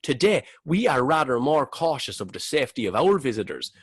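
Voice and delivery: put-on Cork accent. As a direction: read the brochure like a Cork tour guide. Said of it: "I'm gonna do it in a Cork accent".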